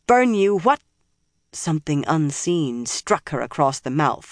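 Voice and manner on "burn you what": loud, high furious curse; angry curse. Acted. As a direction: spit the curse out. Nynaeve curses, then struck.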